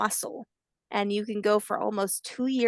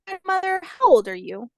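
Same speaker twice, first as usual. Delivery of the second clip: high on grandmother, then louder; curious hatchling; 8 semitones higher, 6 dB louder than usual. Acted. Hatchling asks grandmother her age, high childlike call.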